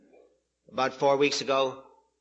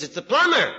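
higher, raised plumber's call; calling through door; 6 semitones higher, 4 dB louder than usual. Acted. Plumber repeating himself to the parrot, higher calling voice. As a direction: announce yourself through a shut door. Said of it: "He says".

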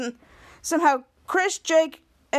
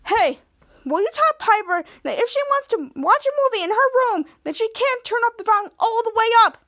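high-pitched, raised-voice tattling; acted indignant complaint; 9 semitones higher, 3 dB louder than usual. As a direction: complain to mom about your sister. Brother complains to mother about sister.

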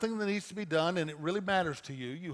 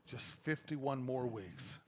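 weary groan; 4 semitones lower, 9 dB quieter, slower than usual. Retold or imitated. Weary volunteer leaving class.

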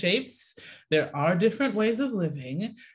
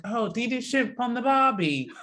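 mimicking friends; 7 semitones higher, 3 dB louder than usual. Mimicking American friends' stereotype question.

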